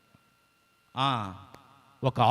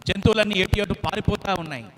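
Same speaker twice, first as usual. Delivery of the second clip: usual speaking voice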